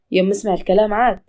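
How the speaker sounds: usual speaking voice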